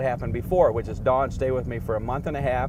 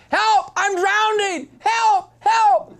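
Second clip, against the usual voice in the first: very high, loud drowning yell; frantic high shouts of help; retold cry for help; 10 dB louder, much slower than usual. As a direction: scream for help as if going under.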